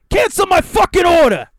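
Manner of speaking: loud, high retold yell; yelling at the phone agent; angry shout. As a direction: bark the demand.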